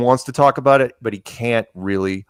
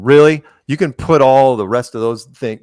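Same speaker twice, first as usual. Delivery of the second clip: mock upset outburst; 3 semitones higher, 6 dB louder than usual. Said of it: "she gets really upset".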